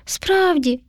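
high, crestfallen little mouse; a small child's let-down question; saddened child. A narrator's reading. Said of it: "засмутилося Мишеня".

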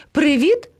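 high, rising hail to friends; high-pitched parrot greeting; calling out. A narrator's reading. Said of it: "гукнув до них".

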